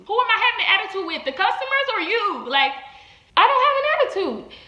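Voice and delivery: fast, high and loud indignant protest; indignant outburst. Real, not acted. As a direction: fire the questions back, outraged. Venting indignation at the accusation.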